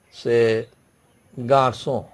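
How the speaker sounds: ordinary delivery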